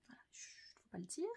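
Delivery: quick whispered aside, like a secret; hushed confession. Pretend. Vlogger owning up to a sewing flaw, hushed whisper. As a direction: drop to a fast, secretive whisper.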